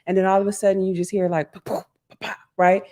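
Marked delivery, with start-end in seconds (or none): 1.5-2.4 voiceless popping bangs; puffed-out "pa" noises for banging; imitated bangs. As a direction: mouth the sound of distant bangs. Imitating sudden noises heard on phone.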